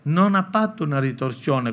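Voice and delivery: usual speaking voice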